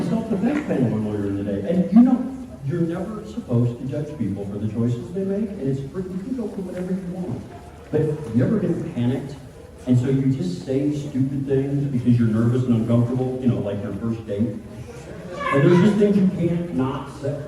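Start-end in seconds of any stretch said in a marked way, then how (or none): none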